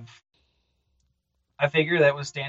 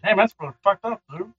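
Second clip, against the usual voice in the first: higher, blunt dismay from the monster; shocked creature; 7 semitones higher, 4 dB louder than usual. Acted. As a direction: react bluntly, half laughing. Creature reacts to lost babies.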